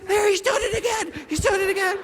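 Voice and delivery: high, wheezy mock shout through laughter; imitated hard laughing. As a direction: squeal it out as if cracking up. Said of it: "He's laughing so hard".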